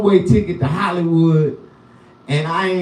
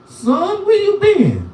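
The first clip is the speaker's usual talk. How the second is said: much higher, incredulous lady; old lady voice; slower than usual. Retold or imitated. Lady on the bus asking the ex-con where he's been, in a high voice. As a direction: squeak it out like a surprised old lady. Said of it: "She was like".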